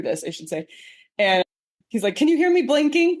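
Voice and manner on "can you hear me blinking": high, louder mock voice; husband's joke voice. Husband joking to his wife about blinking, high mock voice. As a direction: ham up his running joke.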